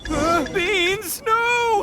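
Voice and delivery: panicked yelp. Acted. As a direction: yelp in half-laughing panic. Itto hit by beans, panics.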